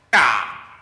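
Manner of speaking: low, deflated sigh of dismay; a lightly played overwhelmed reader; dismayed groan. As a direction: sag at the sheer amount. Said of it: "looked at it going".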